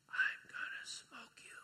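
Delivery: slow, breathy whisper of a weak patient. Retold or imitated. Daughter just off the ventilator, speaking in a faint, slow whisper. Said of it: "in her very weak voice".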